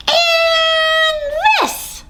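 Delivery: tiny hero yelling; mouse battle shout. Acted. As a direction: charge the grass blade bravely. Mouse attacking grass.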